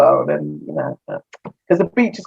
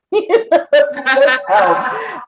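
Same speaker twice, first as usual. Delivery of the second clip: repeating it through a laugh, pitched up; 8 semitones higher, much slower than usual. Real, not acted. Laughing to the host about asking clerks to slow down. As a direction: giggle the words out.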